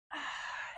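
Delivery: long breathy sigh of relief; relaxed sigh. Real, not acted. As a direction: let out a slow sigh. Relaxed sigh mid-stretch.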